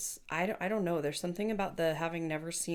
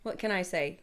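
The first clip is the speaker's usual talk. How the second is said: ordinary delivery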